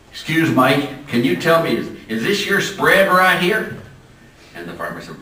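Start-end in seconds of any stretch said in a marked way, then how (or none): 0.1-3.8 louder and higher, a hammed-up Texan rancher; imitating a loud Texan. Texan leaning out of his Cadillac to a farmer, loud and high. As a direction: call out of the car window like a brash Texan.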